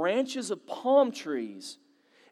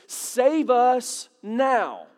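"Save us now" spoken very slowly, weight on "save"; slow meaning; 3 semitones higher, 3 dB louder, much slower than usual. Shown as a demonstration. Preacher spells out what the word means, "Save us now" very slow. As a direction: draw out "Save us now", every word apart. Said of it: "it literally means".

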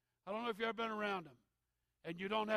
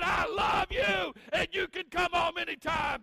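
loud, high, slowed loving call; mother's welcome; 10 semitones higher, 12 dB louder than usual. Retold or imitated. Mother to returning son, loud and loving. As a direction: call out love like Mama did. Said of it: "She said".

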